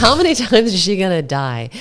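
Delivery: loud, laughing mock despair; laughing exasperation. Joking about her near-crashes on film, loud laughing. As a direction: laugh through the question.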